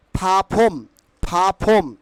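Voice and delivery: "প্ল্যাটফর্ম" said pha-phom, no l, t or r; puffed PHA-PHOM for "প্ল্যাটফর্ম"; corrected pronunciation. Shown as a demonstration. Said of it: "But pronunciation কিন্তু".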